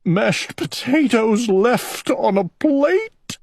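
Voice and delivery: sobbing. A narrator's reading. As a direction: cry the words out through sobs. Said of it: "he cried".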